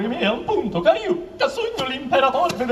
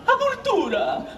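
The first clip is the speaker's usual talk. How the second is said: grand exclamation; 11 semitones higher, much slower than usual. Acted. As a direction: proclaim it like a king.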